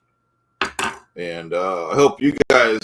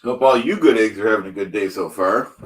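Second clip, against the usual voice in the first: usual speaking voice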